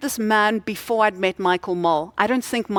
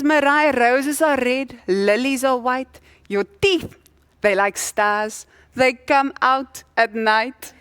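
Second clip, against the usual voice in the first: slow, louder, higher comic recital; mock love poem; 3 semitones higher, 3 dB louder, slower than usual. Husband proudly reciting a clumsy love poem to his wife, slow, louder and higher.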